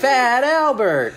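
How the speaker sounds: high, drawn-out yell; sketch punchline shout. Retold or imitated. A sketch performer hollers the jolly man's name, shouted punchline. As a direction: belt out the name, long and high.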